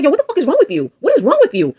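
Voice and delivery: high, sharp yelled retort at a blocker; retold angry yell. Yelling at harasser blocking door.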